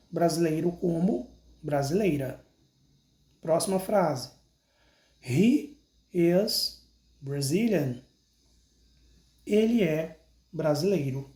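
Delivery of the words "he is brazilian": slow, clear "I am Brazilian" for pupils to repeat; slow model sentence. Shown as a demonstration. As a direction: say each word of "I am Brazilian" slowly so they can copy. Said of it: "Então, repita comigo". (devagar e claro, para o aluno repetir)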